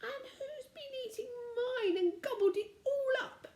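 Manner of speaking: very high, crying little bear; weeping baby bear. A narrator's reading. Baby bear finds his porridge gone, weeping high. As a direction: wail it like a crying cub. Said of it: "wept the Baby Bear".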